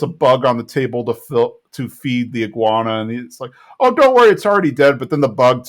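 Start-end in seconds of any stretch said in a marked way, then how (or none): 3.8-5.0 loud, high, hammed-up reassurance; loud mock reassurance. Bill reassuring the family about the bug, loud and high. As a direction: boom it out as if that settles it.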